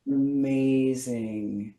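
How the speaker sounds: drawn out, very slow and soft; very slow demo. Shown as a demonstration. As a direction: stretch every sound slowly. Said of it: "the slower you go".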